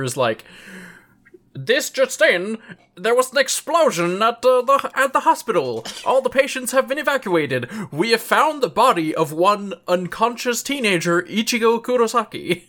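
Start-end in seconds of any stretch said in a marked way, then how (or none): 1.5-12.6 news anchor voice. Acted. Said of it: "love your news anchor voice".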